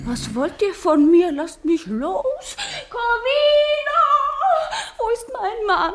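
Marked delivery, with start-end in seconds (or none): 1.3-5.9 panicked plea. Acted. Woman fighting off advances.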